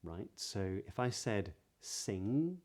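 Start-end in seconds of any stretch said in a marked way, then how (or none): 1.8-2.6 "sing" slowly, ending on ng with no g; drawn-out "sing" on a nasal ng; modern british accent. Shown as a demonstration. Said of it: "the G isn't pronounced".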